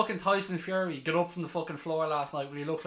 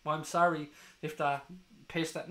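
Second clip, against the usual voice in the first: ordinary talk, nothing marked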